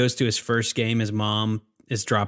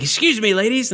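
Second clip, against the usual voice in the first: high, louder mock polite; Brickma voice; 10 semitones higher, 4 dB louder than usual. Retelling Brickma greeting the girls in a high voice. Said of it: "He's like".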